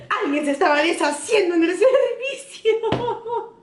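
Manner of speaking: high-pitched mock mom, in Spanish; mom's voice. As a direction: imitate mom's complaint with a laugh in it. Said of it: "she was like".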